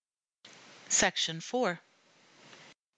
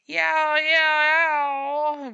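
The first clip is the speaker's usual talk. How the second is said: loud, high, long yowls; rumbling cat yowl; 9 semitones higher, 9 dB louder, much slower than usual. A narrator's reading. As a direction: yowl and hold it. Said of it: "rumbled the yellow peril".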